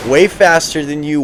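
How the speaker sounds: ordinary delivery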